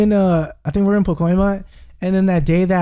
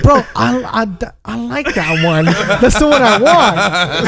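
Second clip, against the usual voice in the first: laughing; much slower than usual. Real, not acted. Retelling while cracking up.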